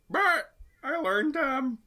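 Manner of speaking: high squawky bird voice, badly faked; a weak try at talking like a parrot. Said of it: "I can't do a parrot voice".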